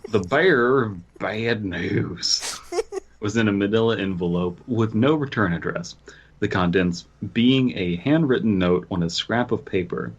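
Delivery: ordinary talk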